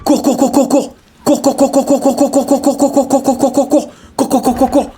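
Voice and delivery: very high staccato "cour-cour" squawks; rapid-fire falsetto syllables, evenly repeated; shrill machine-gun run of identical chirps; vowel warning burst. Pretend. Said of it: "voyelle dans trois, deux, un".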